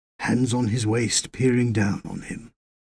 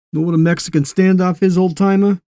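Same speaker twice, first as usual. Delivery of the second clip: quick, higher, louder Yank voice; Yank soldier; 5 semitones higher, 7 dB louder than usual. A narrator's reading. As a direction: challenge the old-timer, fast and raised. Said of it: "said Will, breaking the spell".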